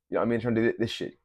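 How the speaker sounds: normal talking voice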